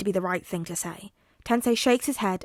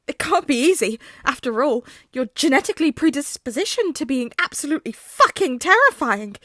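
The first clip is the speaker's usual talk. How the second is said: higher, louder heartfelt gush; overflowing with earnest emotion; gushing hero; 4 semitones higher, 5 dB louder than usual. Acted. Tensei gushing emotionally at Hazashi.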